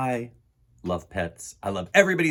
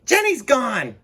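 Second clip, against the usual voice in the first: loud, much higher panicked shout, slowing at the end; Emily shouts; 13 semitones higher, 8 dB louder, slower than usual. Acted. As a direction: yell in a panic about the lost snake. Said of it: "Emily shouts".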